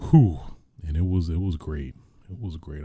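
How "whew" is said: usual speaking voice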